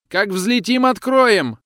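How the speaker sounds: high, loud panicked shout; frightened shout. A narrator's reading. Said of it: "кричит он бледнее". (испуганный крик, высоко и громко)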